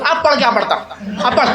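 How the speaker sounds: mock the old man's heated taunt at the imam; worked-up old man. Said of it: "ایک بوڑھا آدمی اتنا جوش میں آ گیا". (بوڑھے آدمی کا جوشیلا طنز، نقل میں)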